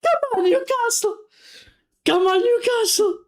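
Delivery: very high, pleading shout; rallying cry. Real, not acted. Fan urging his team to hold on, high rallying cry. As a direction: urge the team on with everything.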